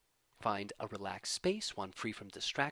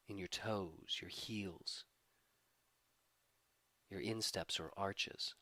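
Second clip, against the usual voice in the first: calm, measured voice easing down at phrase ends; guided meditation. Acted. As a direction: gently lead a body scan, unhurried, letting each phrase settle. Leads a body-scan meditation.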